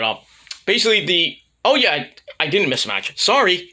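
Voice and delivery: ordinary talk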